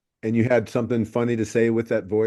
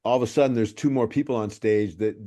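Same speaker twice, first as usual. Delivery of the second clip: ordinary talk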